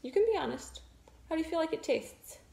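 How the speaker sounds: muffled into palm; speaking through hand. Real, not acted. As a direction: face pressed against hand. Seen 0: Woman leans face into hand, mouth covered while asking question.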